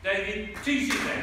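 loud, high announcement of the name; calling out name. Real, not acted. Rally host introducing the speaker with a loud call. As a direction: boom out the name to bring him on.

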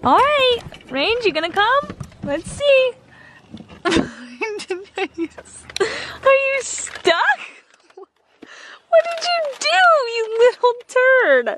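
high teasing pet voice; coaxing pet talk. Real, not acted. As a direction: coax the stuck puppy fondly. Coaxing dog out.

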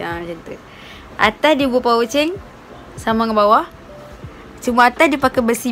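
finger in mouth while talking; speaking with lip held open; lip pulled down by finger. Real, not acted. Seen 0: Finger pulls lower lip down to show braces at 0.3-0.6 and 1.7-1.9.

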